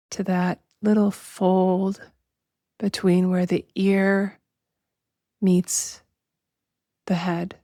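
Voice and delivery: slow, measured meditation voice with long pauses; drawn-out, even-toned relaxation guidance; guided meditation. Acted. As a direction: guide listeners unhurriedly into body awareness.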